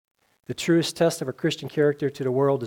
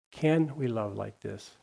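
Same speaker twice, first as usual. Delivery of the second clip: slow, searching repeat; 2 semitones lower, slower than usual. Shown as a demonstration. Pastor pressing the question on the church, slow. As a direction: let each word sink in, slowly.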